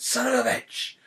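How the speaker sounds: sudden high yelled swear; shouted curse. Retold or imitated. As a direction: blurt it out as you fall. Fell over, dropped phone.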